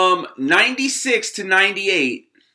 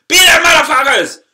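higher and louder, yelling it out; shouted sign-off; 7 semitones higher, 9 dB louder than usual. Real, not acted. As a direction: blast the sign-off at the crowd. Outro sign-off repeated louder.

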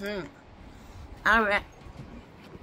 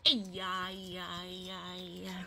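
low, very soft comforting murmur; soothing; 8 semitones lower, 14 dB quieter, slower than usual. Pretend. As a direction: croon quietly to settle a crying doll. Soothing a crying doll.

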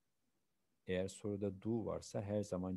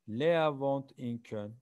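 louder, slow, clearly spoken model sentence; teacher projecting a model answer; emphatic model answer; 4 semitones higher, 8 dB louder, slower than usual. Shown as a demonstration. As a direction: say it loud and slow for the class. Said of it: "teacher models German answer".

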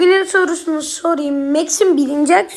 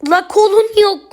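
sudden louder, higher shout; short frustrated shout at the screen; frustrated yell; 5 semitones higher, 5 dB louder than usual. Real, not acted. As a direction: blurt it out in annoyance. Frustrated outburst at an enemy.

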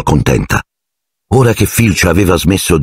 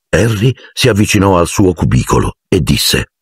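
usual speaking voice

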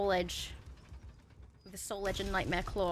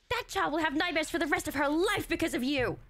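higher, louder hammed-up scolding; a dramatic lecture to the bad guy; mock outrage; 7 semitones higher, 4 dB louder than usual. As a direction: berate the villain in theatrical indignation.

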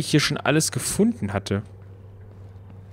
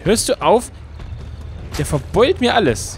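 raised, exasperated yell at the car; annoyed outburst; 7 semitones higher than usual. Real, not acted. Gamer yelling at a companion who keeps denting the car, annoyed. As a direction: shout at him to stop wrecking it.

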